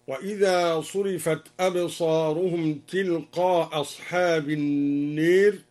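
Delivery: تلقاء without its final hamza, short long-a, then النير tilted to e; dropped hamza, short madd. Shown as a demonstration. Teacher shows the short option, تلقاء losing its hamza. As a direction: run تلقاء into أصحاب on one hamza, vowel kept short. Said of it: "بإسقاط الهمزة مع القصر والمد هكذا".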